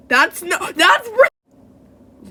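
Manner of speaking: loud, high, exasperated protest; frustrated outburst. Real, not acted. Voice actor botching the accent, loud frustrated outburst. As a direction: burst out at your own mistake.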